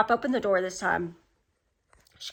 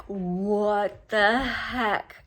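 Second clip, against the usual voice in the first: stretched-out, drawn-slow bafflement; baffled disbelief; much slower than usual. Retold or imitated. Retelling her reaction to a movie scene, baffled. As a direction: draw each word out slowly in mock disbelief.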